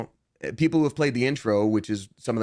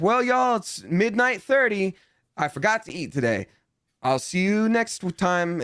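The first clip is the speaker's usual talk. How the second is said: much higher, cheery wrap-up voice to the audience; streamer sign-off; 7 semitones higher than usual. Retold or imitated. Streamer signing off to his chat at night, mock chipper voice.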